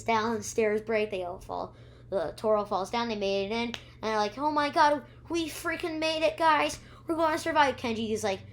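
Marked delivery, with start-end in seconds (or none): 4.4-7.8 higher, louder burst of relief; relieved excitement. Acted. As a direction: cheer like you just escaped. Kids celebrate their escape.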